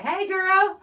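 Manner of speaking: high, loud sing-song hello; mock-cheerful friend walking in; sweet greeting. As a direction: greet her brightly and sweetly. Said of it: "they like".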